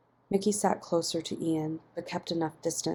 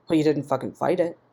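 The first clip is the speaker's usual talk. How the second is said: snapped; 5 dB louder, faster than usual. A narrator's reading. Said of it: "Mickey snapped".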